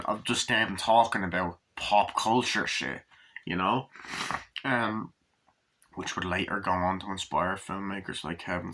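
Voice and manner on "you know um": normal talking voice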